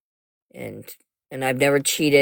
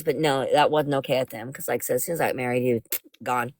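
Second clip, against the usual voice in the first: ordinary delivery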